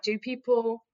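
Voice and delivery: ordinary talk, nothing marked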